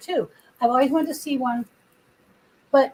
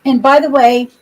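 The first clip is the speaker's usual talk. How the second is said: ordinary talk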